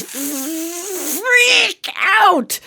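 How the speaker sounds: "freak" with the F held forever, then yelped high; long hung-on F. Retold or imitated. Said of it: "I hung on that F forever".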